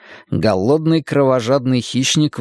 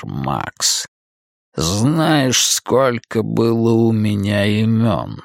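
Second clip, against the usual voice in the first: sinister magician; 3 semitones lower, slower than usual. Acted. Cain continues his speech.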